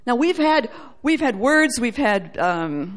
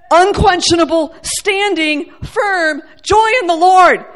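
raised, forceful, deliberate declaration; emphatic proclamation; 4 semitones higher, 7 dB louder than usual. Real, not acted. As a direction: hammer each word home from the pulpit. Preacher hammers the declaration.

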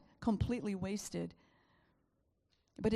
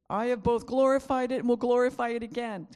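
booming, much louder heavenly voice; 3 semitones higher, 9 dB louder than usual. Acted. Preacher voices God speaking from heaven, booming. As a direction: proclaim it as the voice of God.

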